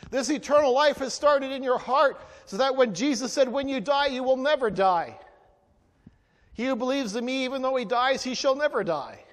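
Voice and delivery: fervent preaching peak; preaching at full force. Acted. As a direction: build it up like a revival preacher.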